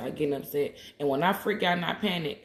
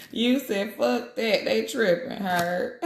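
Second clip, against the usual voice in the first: high, louder read-out, breaking into laughter; laughing while reading; 7 semitones higher, 5 dB louder, slower than usual. Real, not acted. Reading a viewer's comment aloud, laughing high and loud. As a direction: read the comment through giggles. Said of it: "I'm tickled".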